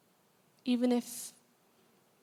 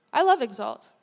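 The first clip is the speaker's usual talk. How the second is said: louder, punch the word love; emphatic declaration; 6 dB louder than usual. Real, not acted. Preacher tells the congregation she loves Exalt: a loud emphatic declaration. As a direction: say it out to the whole room.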